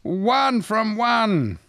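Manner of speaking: high, drawn-out game show cheer; triumphant host. Pretend. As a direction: announce the win with stretched-out delight. Quiz host announces right answer.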